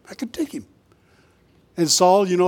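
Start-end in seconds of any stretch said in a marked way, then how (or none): 0.1-0.6 hushed, confident aside; whispered boast. Acted. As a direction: whisper it as if egging yourself on. Repeats David's boast under breath.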